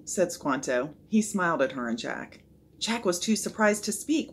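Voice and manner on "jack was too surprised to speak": plain reading voice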